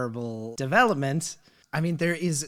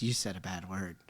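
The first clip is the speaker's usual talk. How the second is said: low, much softer accusation; hushed tattling child; 8 semitones lower, 10 dB quieter than usual. Retold or imitated. Daughter's friend calling out an adult's word, hushed. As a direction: imitate a child quietly pointing out a sin.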